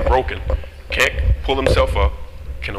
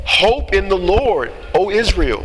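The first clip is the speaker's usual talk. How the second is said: joyful proclamation; 8 semitones higher, 3 dB louder than usual. Shown as a demonstration. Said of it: "he's singing a song that's joyful".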